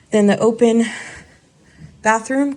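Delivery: winded after the stairs; puffing a little from the climb; out of breath. Real, not acted. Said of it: "Girl, I'm winded".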